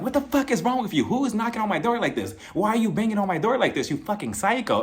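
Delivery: high-pitched angry tirade, retold; imitated outrage. Kenya confronting whoever banged on her door, imitated outrage.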